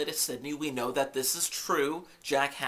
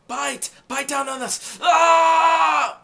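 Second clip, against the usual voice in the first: high, loud shouts into a long yell; acted pain scream; 13 semitones higher, 14 dB louder, slower than usual. Acting out the broken bone being set, pained scream. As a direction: shout through it, then scream in mock agony.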